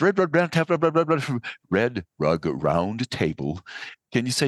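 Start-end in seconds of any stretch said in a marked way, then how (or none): 0.0-1.4 high-pitched, rapid-fire tongue twister; tongue twister at speed. Shown as a demonstration. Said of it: "say that three times fast". 1.7-3.6 tongue twister taken slowly, word by word; slow right down and set each word apart; slow, careful enunciation. Shown as a demonstration. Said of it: "say that three times fast".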